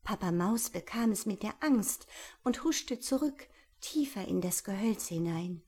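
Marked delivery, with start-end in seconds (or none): none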